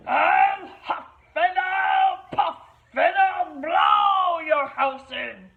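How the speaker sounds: big bad wolf shouting. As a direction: shout the threat at the house. Wolf threatens.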